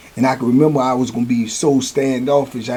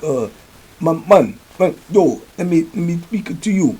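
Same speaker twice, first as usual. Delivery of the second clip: higher-pitched imitation of an African accent; 4 semitones higher than usual. Chief pulls up on the new inmate, imitated African accent. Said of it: "African accent".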